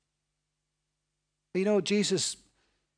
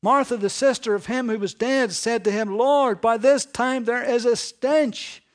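louder, higher, rising sermon drive; preaching at full force; 6 semitones higher, 8 dB louder than usual. Acted. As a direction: build the story to its climax. Preaching climax.

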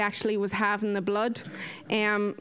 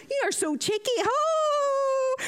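high playful squeal rising to a cry; much higher squealed protest; 13 semitones higher, slower than usual. Retold or imitated. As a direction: squeal in mock outrage. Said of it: "would have squealed".

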